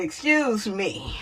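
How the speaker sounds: ordinary talk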